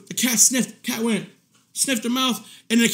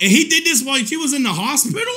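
ordinary talk, nothing marked

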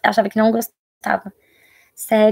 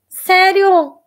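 much higher, louder surprised gasp of a question; delighted surprise; 8 semitones higher, 4 dB louder than usual. Real, not acted. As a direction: caught off guard with joy. Surprised kids watch her. (surpresa encantada)

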